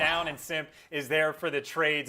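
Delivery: steady caster commentary; play-by-play. Real, not acted. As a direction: call the map as it happens.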